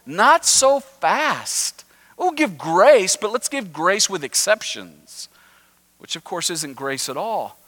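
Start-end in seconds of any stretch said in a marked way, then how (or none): 0.0-5.3 higher, dragging out the objection; mock protest. Pharisees objecting from the side, mock protest. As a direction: hold the brakes on grace. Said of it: "off to the side going".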